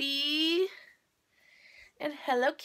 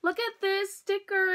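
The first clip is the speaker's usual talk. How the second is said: ordinary talk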